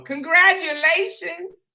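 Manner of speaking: high, slow, sing-song congratulations. Retold or imitated. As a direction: gush it out like an overly sweet clinic worker. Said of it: "That's what she said to me and my mama".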